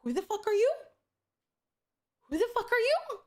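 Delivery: high, rising disbelief; incredulous comeback. Retold or imitated. Streamer retelling a comeback to a rude player, incredulous.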